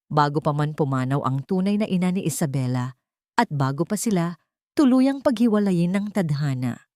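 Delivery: ordinary delivery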